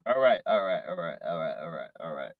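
low murmur, dropping steadily away; muttered, fading. Real, not acted.

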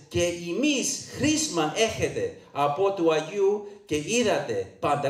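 Cypriot Greek reading; original Greek dialect. Shown as a demonstration. Preacher reading scripture to the congregation, Cypriot Greek dialect. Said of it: "in the original dialect".

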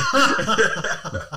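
a burst of loud high laughter; big high laugh. Real, not acted. Friend cracking up at his own true story, laughing loudly.

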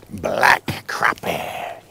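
slow whisper. Real, not acted. Quiet admiration of catch.

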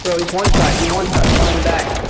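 loud excited callout; hyped gamer bro. Real, not acted. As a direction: shout it out hyped mid-fight. Hyped callout of one-shot enemy.

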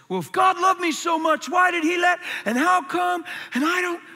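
high, loud whiny complaint; mock complaint. Shown as a demonstration. Doubter challenging God in a demo, high whine. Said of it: "Watch this".

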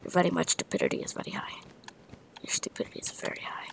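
whispered fake-broken English taunt; mock whispered insult. Gamer whispering goofy insults at an opponent, mocking. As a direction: hiss the silly insult under your breath.

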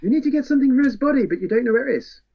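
lightly voiced cheerful sinister vampire; Caldania. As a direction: rattle off horrors like helpful advice. Caldania's disturbing advice.

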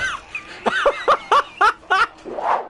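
very high squealing laughter; helpless high-pitched cackle; shrieking laugh. Real, not acted. Streamer in hysterics at the game, squealing laugh.

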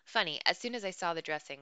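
plain reading voice

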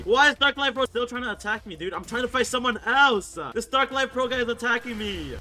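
loud, high, harried protest; exasperated complaint. Real, not acted. Gamer attacked while fighting someone else, loud exasperation. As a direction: raise your voice in frustration mid-fight.

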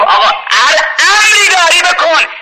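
high pleading voice; humble plea. Retold or imitated. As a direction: beg the cleric humbly. Butcher pleads with Mirza.

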